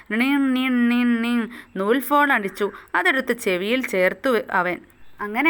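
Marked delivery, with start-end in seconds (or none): 0.1-1.5 a sung two-note imitation of a toy phone ringing; mock phone ring. Teacher imitating the string phone ringing, playful ring sound. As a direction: ring like a tin-can phone.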